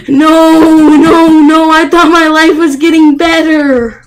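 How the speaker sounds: very loud, high cries of despair; anguished shouting. Real, not acted. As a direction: scream no as the run collapses. Falls in game, despairs.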